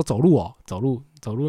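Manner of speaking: loud, incredulous burst of "走路哦"; surprised exclaim. Retold or imitated. Clerk reacts to walking guest. (柜台惊讶地大声重复"走路")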